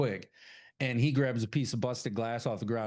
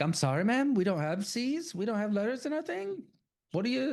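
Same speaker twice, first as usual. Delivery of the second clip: high, put-on voice for the clerk; 11 semitones higher than usual. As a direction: squeak it up as the clueless clerk. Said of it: "And she's like".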